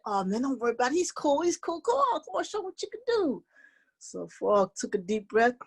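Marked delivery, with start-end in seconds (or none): 0.1-3.4 high, urging chatter climbing in pitch; reassuring animal pals. Acted. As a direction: egg a friend on in a high critter voice. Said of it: "Bird and Crick said".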